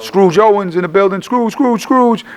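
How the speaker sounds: high, hyped rapper shout-out. Acted. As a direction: call the name out like a hype man. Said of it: "I just had to give the shout out".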